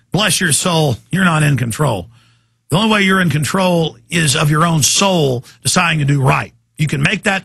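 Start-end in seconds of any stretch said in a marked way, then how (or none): none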